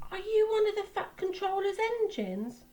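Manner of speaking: high, small, eager engine voice. Acted.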